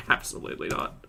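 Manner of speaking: breathy, dismissive whisper; whispered refusal. Real, not acted. Host dismissing a disrespectful caller, whispered. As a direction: shut it down under your breath.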